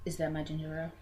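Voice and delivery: low, quiet, deadpan question; mock stern friend; mock serious. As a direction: imitate her stern ginger ale complaint. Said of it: "She was so serious".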